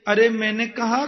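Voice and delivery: usual speaking voice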